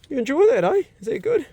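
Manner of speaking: high, sing-song pet talk; doggy praise voice. Real, not acted. Praising the dog after food.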